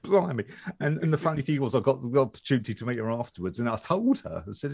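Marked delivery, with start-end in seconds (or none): 0.0-0.4 surprised. Retold or imitated. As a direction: gasp it out, high. Retold surprise.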